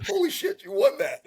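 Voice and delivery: very high, squeaky-pitched mock astonishment; amazed. Husband reacting to wife winning the auction, acted amazement. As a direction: let the voice leap up in disbelief.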